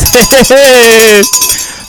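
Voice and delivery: laugh. Real, not acted. Joking about networking at the fest, giggling laugh. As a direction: laugh in a held run of hehehe.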